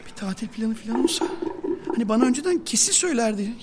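usual speaking voice

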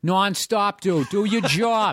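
high, hammed-up Boston accent; Boston guy with rounded "aw" vowels. Shown as a demonstration. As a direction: show the real Boston "aw" in "Nonstop" and "job". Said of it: "no, that's Midwest".

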